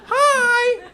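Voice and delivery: high chirpy greeting; a squeaky cartoon-chicken hello; cutesy mascot hi.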